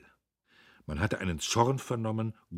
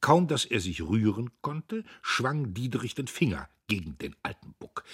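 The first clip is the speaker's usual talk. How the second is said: ordinary delivery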